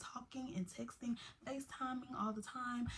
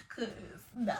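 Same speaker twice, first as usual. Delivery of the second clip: usual speaking voice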